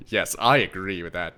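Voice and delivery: ordinary talk, nothing marked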